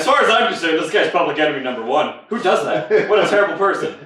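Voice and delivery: playful mock indignation; mock outrage. Real, not acted. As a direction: rant like he's public enemy. Outraged rant about character.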